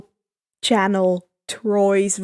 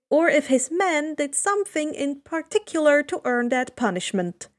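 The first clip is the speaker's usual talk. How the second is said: ordinary delivery, nothing marked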